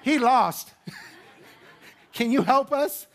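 a laughing, hammed-up confession to God; panicked parents' prayer. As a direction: play the embarrassed parent owning up to God. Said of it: "Can you imagine that prayer?".

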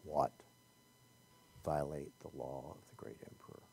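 low, soft growling lion voice. Acted. Preacher voicing the lion who growls at a question. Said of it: "He growls back. You can hear this voice".

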